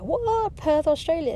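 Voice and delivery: mock astonished echo; surprised. She hears they moved to Perth, surprised. Shocked at his answer.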